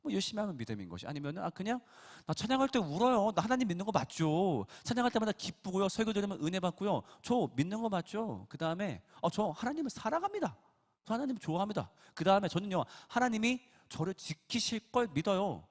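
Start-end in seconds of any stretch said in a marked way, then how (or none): 1.4-8.5 high, eager churchgoer voice; a lightly voiced eager congregant; naive believer. 9.2-11.9 high, eager churchgoer voice; a lightly voiced eager congregant; naive believer. Believer voiced professing love. 12.5-15.7 high, eager churchgoer voice; a lightly voiced eager congregant; naive believer.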